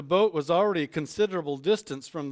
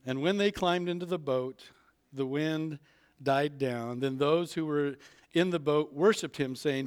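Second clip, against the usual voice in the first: ordinary delivery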